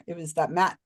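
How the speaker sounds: usual speaking voice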